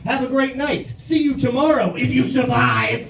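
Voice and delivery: mock Philly local.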